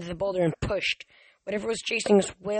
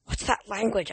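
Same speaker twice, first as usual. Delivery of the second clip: ordinary delivery, nothing marked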